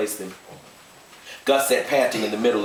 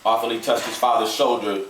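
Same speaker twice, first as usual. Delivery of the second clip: ordinary delivery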